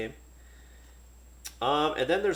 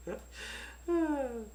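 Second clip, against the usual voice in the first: short high laugh; awkward laugh; 13 semitones higher, 5 dB quieter than usual. Real, not acted. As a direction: laugh at yourself.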